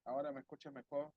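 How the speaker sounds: faint, distant audio; bad connection. Real, not acted. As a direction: voice far off and muffled. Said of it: "sí se te escucha, bueno".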